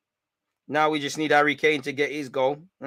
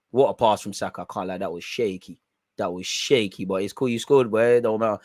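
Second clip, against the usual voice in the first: normal talking voice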